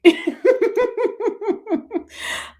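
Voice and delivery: high, loud, long burst of laughter; hearty laugh. Real, not acted. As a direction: laugh out loud, really amused. Big laugh alone.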